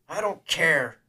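high, very loud furious scream. A narrator's reading. Man stepping toward his armed enemy, screaming in rage. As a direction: scream it out at full force. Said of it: "took a step forward and screamed".

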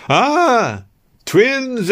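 put-on thrilled old nurse crying out in joy; delighted nurse. A narrator's reading. Nurse delighted at hearing of twins, high delighted exclaim. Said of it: "exclaimed the nurse".

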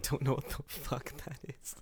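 hushed, breaking into near-whisper with laughter; helplessly amused, voice giving out; laughing bafflement. Real, not acted. Baffled, laughing at unknown word.

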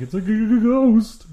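high stammering mock fright; scary voice. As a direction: stutter out ghost like spooked. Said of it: "they're like".

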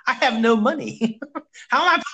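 high, laughing disbelief; amused, half-laughing objection; laughing protest. Retold or imitated. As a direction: laugh through the complaint. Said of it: "And I'm like".

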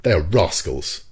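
slow, emphatic condemnation; firm, mildly angry retort; sharp reply. A narrator's reading. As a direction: pronounce judgement sharply. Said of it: "replied Ned Land sharply".

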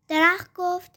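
ordinary delivery, nothing marked

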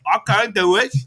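high, loud hammed-up British; mock British accent. Carlisle argues with Edward, exaggerated British accent. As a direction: squawk it as a cartoon Brit. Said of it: "Dude, British people".

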